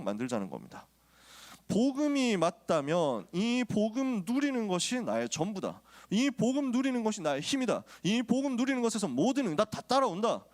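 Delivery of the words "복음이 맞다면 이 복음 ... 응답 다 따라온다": fervent preacher urging the flock on; preaching at full force. Acted. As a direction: press each promise home from the pulpit. Climax of sermon.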